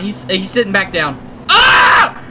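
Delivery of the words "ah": sudden high angry yell of frustration; frustrated yell. Real, not acted. Gamer yelling at his sleeping partner, loud frustrated yell. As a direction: let out a loud yelp of frustration.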